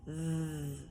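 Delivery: th voiced and buzzing, tongue between teeth; hard th. Shown as a demonstration. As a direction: hum th so the jaw vibrates. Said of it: "Let's try that again".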